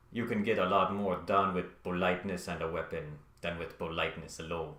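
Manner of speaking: a bad, hammed-up Russian accent; Vladimir Putin in a terrible Russian accent. Quoting Putin's line to viewers, in a deliberately bad Russian accent. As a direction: lay on a clumsy Russian accent, knowingly bad. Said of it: "I know that's a terrible accent".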